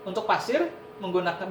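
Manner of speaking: usual speaking voice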